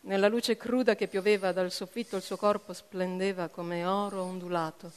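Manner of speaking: ordinary delivery, nothing marked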